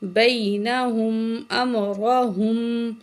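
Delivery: slow, drawn-out tajweed chanting; Quran recitation. Shown as a demonstration. Teacher recites the verse for students, slow melodic chant. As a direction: recite slowly with full nasal hum.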